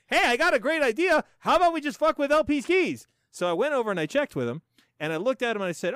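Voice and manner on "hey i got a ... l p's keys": high, loud scheming gremlin; excited troublemaker with a bright idea; mischievous gremlin. Retold or imitated. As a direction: pitch it up like a plotting gremlin. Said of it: "some fucking gremlin didn't come in".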